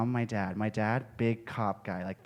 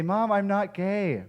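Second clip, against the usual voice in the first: higher, slow mock-earnest denial; 8 semitones higher, slower than usual. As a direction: protest it a little too sweetly. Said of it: "And I say".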